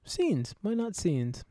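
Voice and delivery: high, hammed-up British accent; silly British accent. Host moving on to the scenes segment, silly British accent. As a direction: put on a silly, drawn-out British voice. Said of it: "just trying to do a silly accent".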